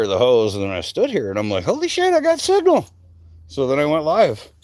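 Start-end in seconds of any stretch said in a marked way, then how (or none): 1.6-2.9 very high squeal of mock amazement; high-pitched excited self-quote; excited discovery. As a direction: squeak it out, thrilled, far above your usual voice. Acting out his excited discovery of signal.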